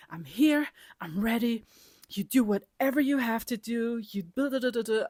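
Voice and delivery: ready-for-anything battle cry; hyped up. Retold or imitated. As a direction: psych yourself up like before a fight. Hyping herself up for the trip.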